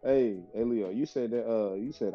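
usual speaking voice

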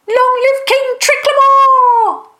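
very high, loud, drawn-out cheer; full-voiced squeaky shout of celebration; cheering acclamation. A narrator's reading. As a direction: cry out in triumph, stretching the last word. Crowd cheer for new king.

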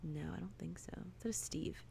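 soft unsure whisper. Real, not acted. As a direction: whisper it, half to yourself. Unsure about character name.

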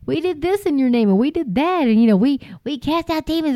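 high, whiny pleading to the Lord. Retold or imitated. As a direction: beg high like people claiming their deeds. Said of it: "many will come and say".

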